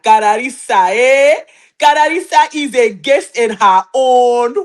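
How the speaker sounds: slow, mock-scolding African accent. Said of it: "you know the African accent".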